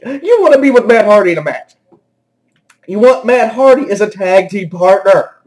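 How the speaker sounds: incredulous outburst, on the verge of cracking up. Real, not acted. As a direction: demand it as if it's absurd. Incredulous repeat before laughing fit.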